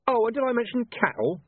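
high, lifted afterthought; mock conversational. A narrator's reading. Commander adds cattle to his complaint, mock conversational. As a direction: toss it in with false casualness. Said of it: "he went on conversationally".

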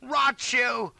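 high, loud curse; outraged high yell; angry cry. A narrator's reading. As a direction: shout the curse in panic. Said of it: "cries I".

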